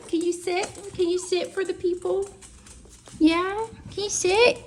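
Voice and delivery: high, soft sing-song coo to the dog; high dog-talk voice. Real, not acted. Owner coaxing her dog to sit, high cooing baby talk.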